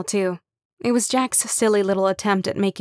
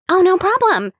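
much higher and louder chirp; chirpy; 11 semitones higher, 6 dB louder than usual. Acted. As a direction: chirp it brightly, all cheer. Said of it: "Kim chirped".

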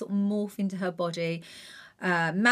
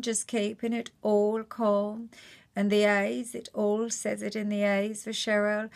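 usual speaking voice

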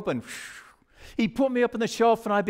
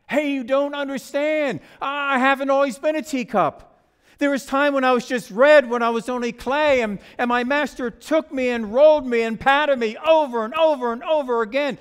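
insistent, higher-pitched teacup; protesting teacup; 4 semitones higher, 4 dB louder than usual. Acted. Teacup telling the couple of its hard past, protesting. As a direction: pour out a grievance, rising in force.

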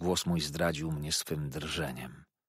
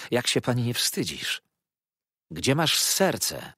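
higher, emphatic acted appeal; indignant reproach; 5 semitones higher, 5 dB louder than usual. A narrator's reading. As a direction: plead with her to be ashamed. Narrator shames Rose for cruelty. (oburzony wyrzut podniesionym głosem)

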